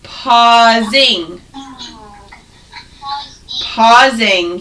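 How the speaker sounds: slow, careful teaching. Shown as a demonstration. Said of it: "For words like pausing".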